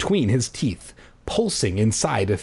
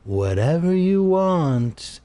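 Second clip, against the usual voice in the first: slow, higher, resigned; a slow, defeated higher voice; sad surrender; 6 semitones higher, slower than usual. Acted. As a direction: give in slowly and sadly. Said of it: "I repeated sadly".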